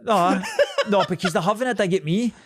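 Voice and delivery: loud, high protest through laughter; laughing protest. Real, not acted. Protests, laughing, at being teased.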